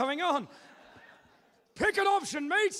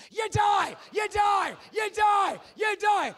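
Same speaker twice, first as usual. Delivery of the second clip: loud shouted chant, high; screaming you die; 7 semitones higher, 8 dB louder, slower than usual. Shown as a demonstration. Host shows the crowd how to scream it. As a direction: bellow it out, pointing at them. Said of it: "turn and point at them and scream".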